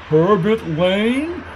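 high, drawn-out quivering dismay; quivering yell. A narrator's reading. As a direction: wail it like a doomed man. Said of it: "yelled Lark in a quivering voice".

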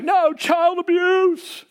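high, drawn-out mock protest; mock outrage. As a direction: wail it as an exaggerated objection. Mocking today's objection to spanking.

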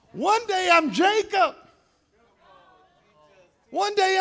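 high, loud preaching cadence; full-force sermon delivery. Acted. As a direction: preach it with rising fire.

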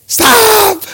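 high-pitched panicked cry; acted-out panic. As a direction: cry out as if a child is in trouble. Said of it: "I'm panicking".

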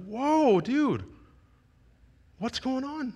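high, softened startled reply; taken aback. Retold or imitated. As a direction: react gently to a child's sudden panic. Startled counselor answers pleading child.

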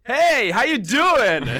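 very high, loud, drawn-out hey; loud mock greeting. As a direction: boom out the greeting across the hall. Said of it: "and you were like".

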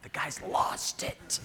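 slow unvoiced whisper of the baby's thought. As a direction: breathe it slowly as the baby's verdict.

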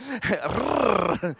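low trilled R after a chuckle; rolled R. Shown as a demonstration. Preacher jokes about rolling R's at neighbours, long trilled R. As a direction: let a long R roll out, low. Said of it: "roll your R's".

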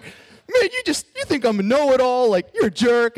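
high, loud, hurt yelling at a friend; angry outburst. Retold or imitated. Retelling yelling at Jordan after his advice, in high loud anger. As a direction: relive the furious comeback, voice jumping up high and loud. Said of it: "I was crying 'cause I was pissed at Jordan".

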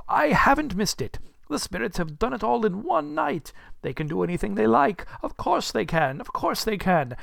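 high, excited self-assurance; overjoyed relief. Acted. Scrooge to himself about the spirits, high overjoyed relief. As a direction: gush with delighted relief.

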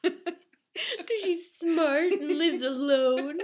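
slow laughing echo. Real, not acted. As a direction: laugh it out slowly.

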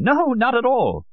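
put-on high, drawn-out, amused old man; slow, high denial with a touch of amusement. A narrator's reading. Old Solon denies his father was a geologist, amused. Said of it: "Solon said, laughing".

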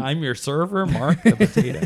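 loud cartoon potato mascot, laughing; a cheery potato greeting the table; talking vegetable. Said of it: "Who does the voice of the potato?".

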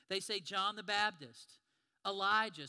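slow then loud called-out names; blurted answers. Acted. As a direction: call out answers like eager students.

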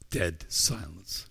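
usual speaking voice

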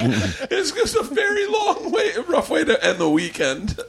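high, drawn-out retold line through laughter; laughing retort. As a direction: start high and stretched, easing down at the end. Retells own reply amid laughter.

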